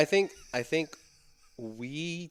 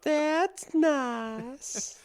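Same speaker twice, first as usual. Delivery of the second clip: high, honeyed Southern put-down; mock-sweet Southern lady. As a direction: play a sugary Southern belle, amused.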